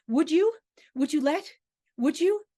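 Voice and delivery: high, loud, broken-off questions; desperate pleading. Acted. Davies begs Aston as he is exiled, desperate pleading. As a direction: plead in rising fragments, never finishing.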